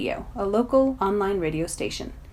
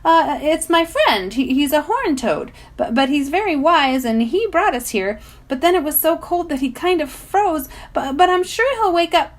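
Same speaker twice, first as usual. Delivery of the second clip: nervously reasonable; 8 semitones higher, 5 dB louder than usual. Acted. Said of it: "trying to sound reasonable and not terrified".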